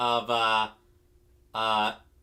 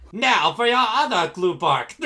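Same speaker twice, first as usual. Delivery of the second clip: high, loud imitated cartoon villain; Frieza; 7 semitones higher, 6 dB louder than usual. Quoting an anime parody villain to friends, high hammed-up menace. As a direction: threaten in a hammed-up villain voice, pitched up. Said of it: "Abridged Frieza".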